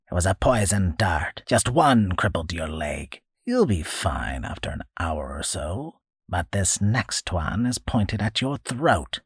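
put-on creaky old man, threatening; an old hermit with a blowgun; menacing old hermit. Old man threatening captured boy, creaky menace.

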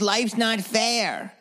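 mocking the therapist's refrain. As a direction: stretch it out condescendingly. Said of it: "And she goes".

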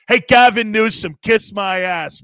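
mock angry yell. Host yelling at Gavin Newsom over show rules, a hammed-up angry taunt. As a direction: bellow the insult, drawing it out.

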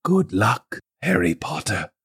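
soft, solemn goodbye; hushed farewell. Acted. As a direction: say it softly, with weight. Dumbledore's final farewell.

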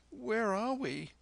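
put-on young boy voice. A narrator's reading. Boy wakes in coach, asks.

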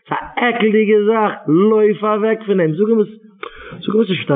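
put-on preacher urging parents to save their children; impassioned preaching.

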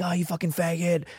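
name-calling friends. Retold or imitated. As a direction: imitate buddies taunting you.

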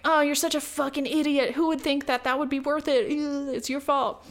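mock the smug commenters. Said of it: "a bunch of fucking dudes are like".

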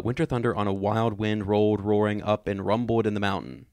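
quick tongue-twister reading. Shown as a demonstration. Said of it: "Let me try to do it faster".

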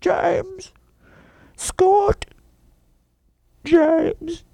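high, plaintive mock wail; whining girl calling out. Podcaster mocking heroine calling boys, high whiny wail. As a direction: wail the names in falsetto. Returns to the whining girl's calls.

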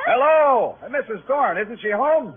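shouting up at a window; calling up. Acted. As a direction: hail someone upstairs, loud and high.

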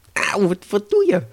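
high, loud mock yelp; pained outrage. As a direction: wake up yelping and protesting. Devil woken by plucked hair. (verontwaardigde hoge pijnkreet)

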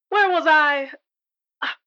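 ordinary talk, nothing marked

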